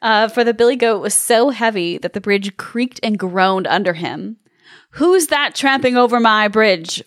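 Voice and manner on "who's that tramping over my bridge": plain reading voice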